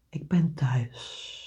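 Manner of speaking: slow, soft unvoiced whisper. Shown as a demonstration. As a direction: breathe the words as a whisper. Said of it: "En fluister naar je hart".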